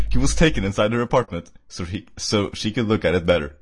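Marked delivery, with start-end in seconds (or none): none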